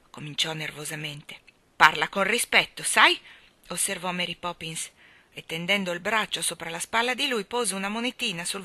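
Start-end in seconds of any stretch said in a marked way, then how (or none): 1.8-3.2 loud, sharp rebuke rising at the end; stern nanny. Acted. Mary Poppins scolds Michael, loud and stern. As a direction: put the boy in his place.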